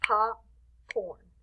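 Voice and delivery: POPCORN split into two separate beats; clap-along POPCORN; syllable by syllable. Shown as a demonstration. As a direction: say POPCORN as POP, pause, CORN. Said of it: "Let's snap or clap".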